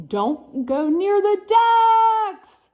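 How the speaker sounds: high, slow, loud warning; high, slow, loud dramatic warning to Hector. Acted. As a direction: sound the alarm in a high, drawn-out voice. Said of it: "Hector was warned".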